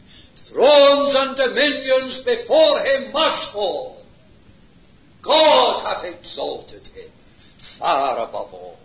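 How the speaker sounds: slow, loud, lifted declaration; grand proclamation. Real, not acted. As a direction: proclaim it like a triumphant hymn. Preacher quotes a hymn grandly.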